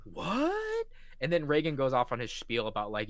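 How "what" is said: very high stretched what; shocked what. Retold or imitated. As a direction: react in disbelief. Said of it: "He's like".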